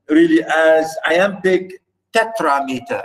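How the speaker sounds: ordinary talk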